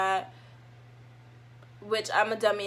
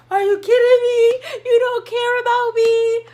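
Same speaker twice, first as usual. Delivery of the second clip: high, loud, tearful wail; jealous shriek; 12 semitones higher, 10 dB louder than usual. Retold or imitated. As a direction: shriek it like a jealous girlfriend losing it. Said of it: "I would be like".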